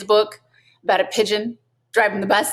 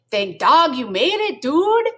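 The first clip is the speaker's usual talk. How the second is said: loud, rising exclamation, dude jumping high; exclaiming; 8 semitones higher, 3 dB louder than usual. A narrator's reading. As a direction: greet your buddy with a happy shout. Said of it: "exclaimed Don Diego".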